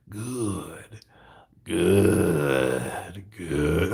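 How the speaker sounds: creepy, long drawn-out words; an eerie, savouring croon; Yoda. Retold or imitated. Quoting Yoda behind Luke, creepy. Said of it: "That was just creepy as hell".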